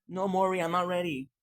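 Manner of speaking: a lightly done scared guest begging the host; mock scared guest. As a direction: play the frightened talk show guest. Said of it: "and say".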